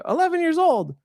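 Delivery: put-on high kid voice. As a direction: answer like an eager little kid, high. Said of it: "And then I say".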